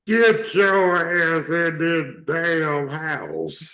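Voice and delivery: high, slow, drawn-out Southern holler; slow-talking lady. Retold or imitated. Imitating the slow-talking lady calling a child inside. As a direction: stretch it out like the country lady yelling. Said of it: "This how she talk".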